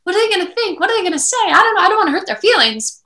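high, fretting mock-anxious voice; hammed-up anxious people-pleaser; anxious worrier. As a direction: lift the pitch high and fret like a worrier. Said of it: "we have to stop this".

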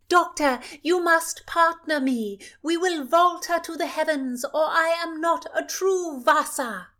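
high, loud and bored royal demand; the princess scolding the doctor, high and loud. Acted. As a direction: cut him off like a spoiled royal. Princess pulls doctor to dance.

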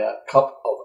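ordinary delivery

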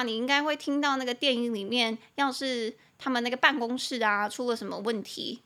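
ordinary delivery

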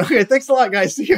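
breezy. Retold or imitated. Cheerful exit from audition.

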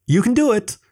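high cheer up the ladder; calling encouragement. A narrator's reading. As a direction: urge him on from below. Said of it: "Sam called up".

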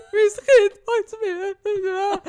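mother sobbing to the teacher, slow wail; retold sobbing. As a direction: wail it out like crying at school. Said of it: "wirklich so gebrüllt".

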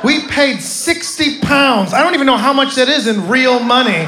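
high, loud, slow disbelief; indignant outrage. Retold or imitated. Comic ranting to his friend about the tour, slow loud outrage. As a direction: rant at the pitiful count. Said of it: "I had to turn to my friend".

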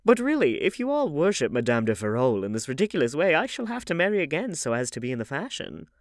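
put-on high, fussy old aristocrat; old lady voice. A narrator's reading. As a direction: chatter like a gossipy grand dame.

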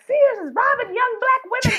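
high-pitched comic proclamation; mock outraged cry. Telling Twitter about Sears, high mock outrage. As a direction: cry it out high, half laughing. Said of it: "screamed like this".

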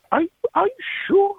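high-pitched mock mother voice, doubtful; imitating mom. Mother hearing her son's recording, doubting it's him, in a high mock voice. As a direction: squeak it up like a disbelieving mom. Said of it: "she was like".

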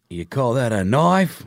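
slow, lightly put-on Australian accent; a mild Aussie drawl on the famous line; Crocodile Dundee Aussie. Quoting Crocodile Dundee in a light Australian accent. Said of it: "That accent was just so over the top".

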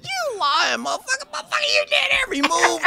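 very high, cracking laughing yell; laughing shout. Retold or imitated. Friend yelling at [name] that he copied every move.

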